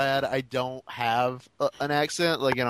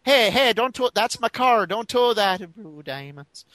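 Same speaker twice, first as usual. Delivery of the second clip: high-pitched mock Irish protest, dropping off at the end; Irish accent; 8 semitones higher than usual. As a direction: protest in a put-on Irish brogue. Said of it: "have an Irish accent".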